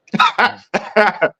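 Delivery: high-pitched wheezing laugh; imitating a high laugh; high giggle. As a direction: cackle like a friend on the phone. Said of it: "he's laughing in the background".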